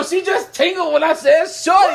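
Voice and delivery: high, loud laughing tease. Real, not acted. Teasing his friend about the word, laughing. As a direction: laugh while teasing her.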